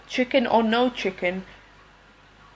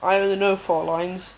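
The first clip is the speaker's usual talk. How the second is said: ordinary delivery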